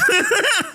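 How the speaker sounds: sudden high-pitched laugh; a sharp squeal of a laugh; high burst of laughter. Real, not acted. Laughing at his own joke.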